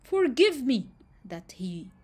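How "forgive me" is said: high, pleading cry for mercy; begging. Acted. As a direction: grovel before the lion. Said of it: "that was the way he talked".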